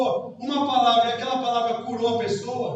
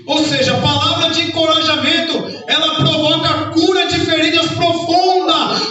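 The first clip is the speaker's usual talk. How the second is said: loud, higher, shouted sermon; preaching at full force; 5 semitones higher, 7 dB louder than usual. Real, not acted. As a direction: build it to a fervent shout. Preaching climax to congregation.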